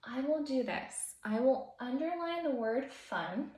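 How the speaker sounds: ordinary talk, nothing marked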